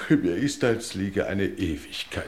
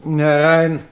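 loud high call; 6 semitones higher, 11 dB louder than usual. Acted. Lord calling the butler in, loud. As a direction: call through the door to come in. Lord calls through the door.

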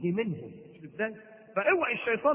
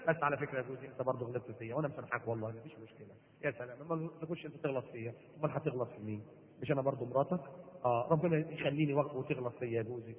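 low, soft conciliatory voice; hushed, tender wifely murmur; calm, forgiving wife; 7 semitones lower, 8 dB quieter than usual. Acted. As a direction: gently reproach a husband who has calmed down. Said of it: "تعرفي بعد ما يهدى".

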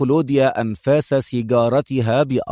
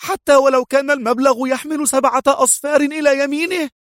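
high, louder sharp retort; high sharp outburst; sharp exclaim; 13 semitones higher, 3 dB louder than usual. Acted. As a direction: snap it back high. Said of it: "هتفت في حدة".

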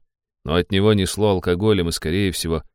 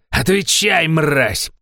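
loud high bark into ear; barked shout; 9 semitones higher, 4 dB louder, much slower than usual. A narrator's reading. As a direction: bark it right in his ear. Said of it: "гаркнул в ухо".

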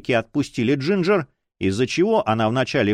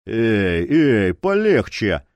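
mocking calm-down; 3 semitones lower, much slower than usual. A narrator's reading. Dor mocks Boul's rage.

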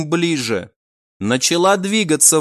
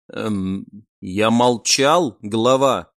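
slow, unsure reply; puzzled; 5 semitones lower, slower than usual. A narrator's reading. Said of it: "непонимающе произнёс он".

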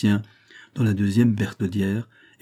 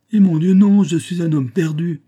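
high, loud cry of despair; desperate, acted exclamation; panicked outcry; 8 semitones higher, 7 dB louder than usual. A narrator's reading. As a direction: wail it like someone ruined. Ruined governor cries out in panic.

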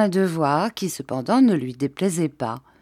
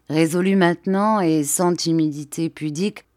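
plain reading voice

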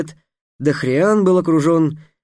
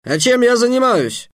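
rude retort; 9 semitones higher, 3 dB louder than usual. Acted. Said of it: "очень невежливо ответил самаршанец".

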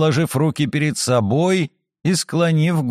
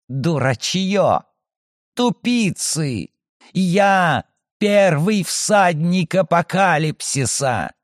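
contemptuous; 4 semitones higher, slower than usual. Acted. Plague spirit insults them.

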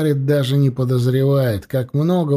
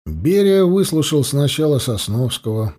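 usual speaking voice

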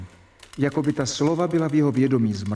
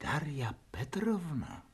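whisper; 13 dB quieter than usual. Acted.

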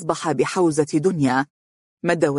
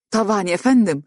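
higher, mock-astonished voice; feigned surprise; 5 semitones higher than usual. A narrator's reading. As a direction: act lightly taken aback at the question. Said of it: "رفعت حاجبيها بدهشة مصطنعة وهي تقول".

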